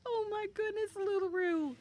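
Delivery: high, gushing hello; excited greeting. Real, not acted. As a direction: gush over a friend, pitch way up. Greeting a friend joining.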